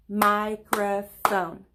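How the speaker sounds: Microphone said slowly in three beats, MI-CRO-PHONE; clapped syllables. Shown as a demonstration. Teacher to kids, slowly clapping out the syllables. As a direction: stretch Microphone out, one clap per part. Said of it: "Let's do it again".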